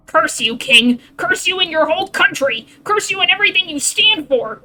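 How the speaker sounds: loud, high, raging curse; full-voiced furious damnation of the king; furious cursing. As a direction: spit hatred at the king.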